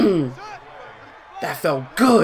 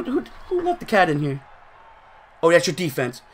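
normal talking voice

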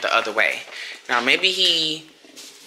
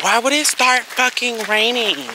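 real frustration; 9 semitones higher, 6 dB louder, slower than usual. Real, not acted. Rain starts while walking dog.

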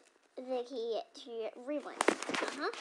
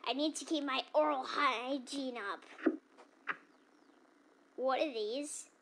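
ordinary delivery

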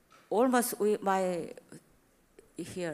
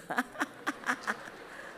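airy laugh; 4 semitones higher, 7 dB quieter than usual. Real, not acted.

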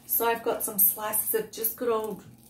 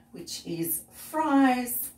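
slow drawn out; 3 semitones higher, 4 dB louder, much slower than usual. Acted. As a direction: stretch out each word. Drawn-out list item.

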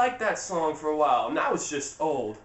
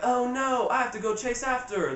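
higher-pitched imitation of a worried girl; mock alarm; 6 semitones higher than usual. Anna seeing her sister run off, in high mock alarm. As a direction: play up the dismay in a high voice.

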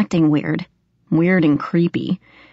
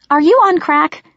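higher, louder disbelief; incredulous; 11 semitones higher, 5 dB louder than usual. Acted. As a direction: snap back in disbelief. Megan glaring, incredulous.

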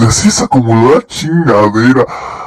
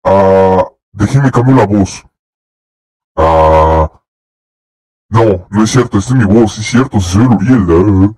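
put-on other voice; 6 semitones lower than usual. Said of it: "voy a poner otra voz".

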